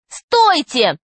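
high, abrupt shout to halt; girl calling out. A narrator's reading. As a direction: cry out to stop someone. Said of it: "крикнула Юлька".

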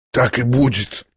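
quiet, hoarse, near whisper. A narrator's reading. Exhausted Ippolit answers Lizaveta, hoarse near-whisper. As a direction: barely voice it, rasping and soft. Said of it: "Тихо, хрипло и чуть не шёпотом".

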